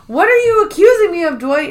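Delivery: high, loud offended question; hammed-up girly outrage; mock indignant Kelly. Kelly confronting Dwight about the accusation, high and loud.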